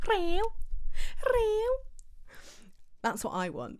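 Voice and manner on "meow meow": put-on high, loud meow with a Scouse accent; Scouse cat impression. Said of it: "really good impression of the cat with a Scouse accent".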